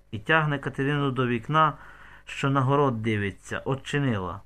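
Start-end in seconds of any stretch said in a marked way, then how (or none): none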